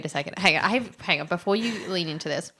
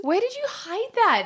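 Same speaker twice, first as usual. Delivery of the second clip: surprised; 12 semitones higher than usual. Real, not acted. Amazed at hidden rock.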